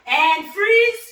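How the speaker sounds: loud, high stop call; called-out freeze. Real, not acted. Coach halting boxers mid-drill, loud call. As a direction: snap the class to a halt.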